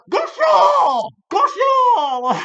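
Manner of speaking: a shrill cartoon old-woman scream, very high and loud; old lady shrieking. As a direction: screech it like the crazed old lady. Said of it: "she's like".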